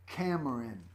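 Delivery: ordinary delivery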